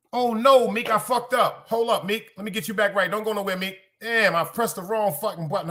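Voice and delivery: real dismay. Real, not acted. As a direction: blurt it out as you realise your blunder. Dismay at pressing wrong button.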